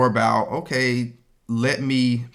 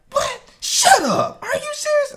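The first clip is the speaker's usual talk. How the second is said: much higher, squealing wife voice; mock disbelief; 3 dB louder than usual. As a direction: play her stunned and outraged. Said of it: "She was like".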